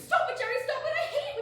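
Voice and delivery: loud, high cry to make him stop; pleading shout. Acted. Wife shouting at morbid Jerry to stop. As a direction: beg him loudly to stop.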